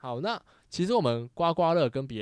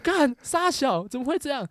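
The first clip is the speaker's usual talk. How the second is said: much higher, louder, slow astonishment; stunned exclamation; 12 semitones higher, 7 dB louder, slower than usual. Retold or imitated. Reacting to a five-thousand win, high slow astonished cry. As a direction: gasp it out, floored.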